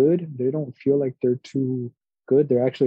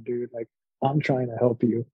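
usual speaking voice

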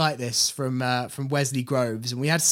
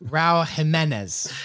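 slow "Raul Jimenez", stressed him-EN-ez; careful corrected pronunciation; 2 semitones higher, slower than usual. Shown as a demonstration. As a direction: sound out "Jimenez" slowly, middle syllable stressed.

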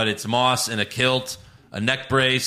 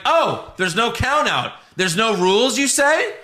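mock surprise; 8 semitones higher, 3 dB louder than usual. Sarcastic reply to retold announcement.

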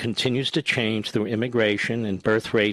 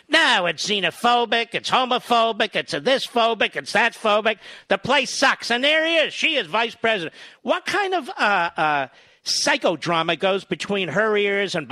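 furious rant; 8 semitones higher than usual. Real, not acted. Host ranting to listeners about the Vice President, furious and high. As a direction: pile on the outrage, pitch rising. Host's rising tirade against Harris.

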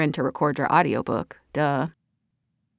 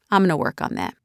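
ordinary talk, nothing marked

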